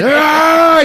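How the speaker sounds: high, loud wild scream; attack yell. Retold or imitated. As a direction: let out a raging scream. David screams, keeps attacking.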